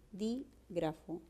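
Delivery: "dígrafo" slowly split, pausing after dí; quiet, drawn-out teacher's syllable demo of "dígrafo"; syllabified. Shown as a demonstration. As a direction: softly break "dígrafo" into dí, grafo. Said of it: "la base es un buen silabeo".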